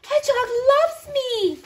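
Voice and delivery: high thrilled squeal of joy; excited squeal. Acted. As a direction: squeal it with delight. Bunny's delighted discovery.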